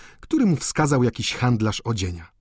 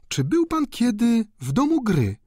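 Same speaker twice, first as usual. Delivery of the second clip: trembling voice; 11 semitones higher than usual. A narrator's reading. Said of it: "spytała drżącym głosem".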